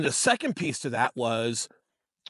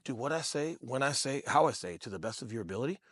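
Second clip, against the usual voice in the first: kneel and say it softly, man to man; quiet encouragement; 6 dB quieter than usual. Retold or imitated. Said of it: "he fucking kneels down and he's like".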